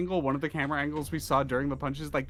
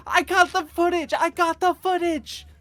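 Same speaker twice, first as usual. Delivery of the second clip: very high, loud triumphant shout; excited calling out; 8 dB louder than usual. Acted. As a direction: shout the news in a squeaky voice. Character runs out calling to group.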